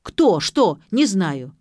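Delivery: loud, staccato outbursts; loud, flustered shouting; yelling. A narrator's reading. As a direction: fire out each word in agitation. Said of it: "заорал он".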